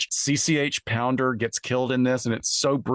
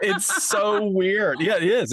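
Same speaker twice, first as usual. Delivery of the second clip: high, drawn-out disgusted reaction; real creeped-out surprise, higher; 11 semitones higher, 4 dB louder, slower than usual. Real, not acted. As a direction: squeal how weird it is. Reacting to creepy scene line.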